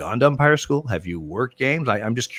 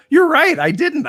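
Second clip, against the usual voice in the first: laughing admission; 6 dB louder than usual. Retold or imitated. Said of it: "he starts laughing".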